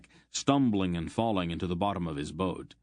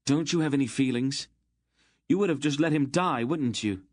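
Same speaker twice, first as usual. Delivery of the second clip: higher, louder and fast; angry outburst; 7 semitones higher, 4 dB louder than usual. A narrator's reading. Geryon accusing Aunt Paul of heartlessness, angry. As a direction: hurl the accusation at her.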